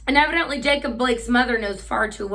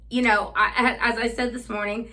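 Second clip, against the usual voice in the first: normal talking voice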